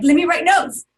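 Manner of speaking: high and loud, keen to please; a keen intern ready with her pen; eager note-taker. Retold or imitated. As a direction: bounce in, eager to write it down. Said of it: "I'm like".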